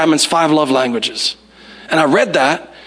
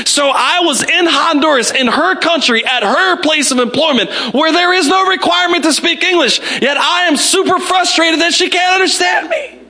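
high-pitched, incredulous tirade; emphatic, exasperated self-mockery; mock frustrated rant; 8 semitones higher than usual. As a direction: build in comic outrage at your own behaviour. Mock-frustrated rant building to shout.

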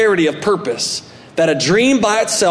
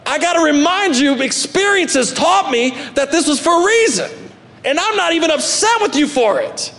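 Joseph insisting; 10 semitones higher than usual. Retold or imitated. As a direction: press the point to frightened brothers. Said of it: "he's gotta remind them later on".

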